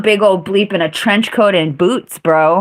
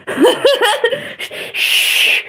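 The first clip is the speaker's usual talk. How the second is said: breathy high-pitched laugh. Real, not acted. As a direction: laugh helplessly at yourself. Laughing at own meltdown story.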